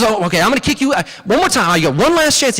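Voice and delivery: high, loud, worked-up threat; final warning in hammed-up anger; Briar Rabbit fuming. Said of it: "he's getting more and more mad".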